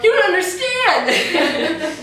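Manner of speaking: high, loud, slow plea to be understood; laughing insistence. Retold or imitated. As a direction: press it home while laughing. Insisting to leader Amy.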